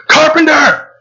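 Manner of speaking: high, loud yell; furious scream. A narrator's reading. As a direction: scream it out in mad fury. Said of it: "He starts screaming".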